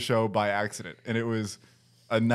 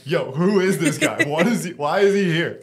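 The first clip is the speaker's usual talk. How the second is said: headline act mocking; 5 semitones higher, 6 dB louder than usual. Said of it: "the fucking headline act is like".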